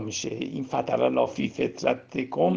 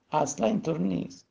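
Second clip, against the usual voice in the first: ordinary delivery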